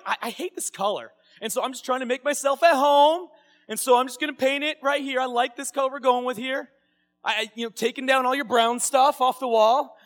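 chatty guest happily redecorating; carefree guest explaining the new paint; cheerful guest. Retold or imitated.

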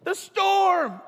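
high, very loud alarm cry; hammed-up frightened yell; panicked shout. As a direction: holler the danger in mock terror. Disciples shout about the storm.

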